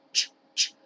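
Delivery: "ch, ch", short and separate; two cut-off "ch" sounds; isolated "ch" sound. Shown as a demonstration. Teacher drilling the first sound, short "ch". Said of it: "Y también el sonido es ch".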